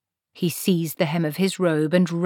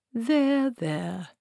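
comforting; 6 semitones higher than usual. Acted. Treatle patting the frightened wall, gently comforting. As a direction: soothe a frightened building.

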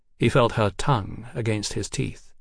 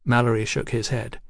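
ordinary delivery, nothing marked

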